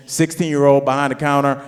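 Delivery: normal talking voice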